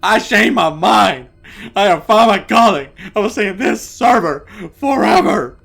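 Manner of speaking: high, loud, impassioned declaration; defiant outcry. Acted. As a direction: proclaim it at full volume, refusing to go. Refusing to leave.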